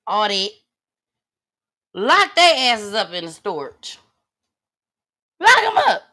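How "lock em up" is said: outraged call. Real, not acted. Outraged ranting about parents.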